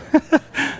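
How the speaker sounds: breathy chuckle without voice; laugh. Real, not acted. Preacher amused by the puzzling verse, short breathy laugh. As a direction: let a short laugh slip out.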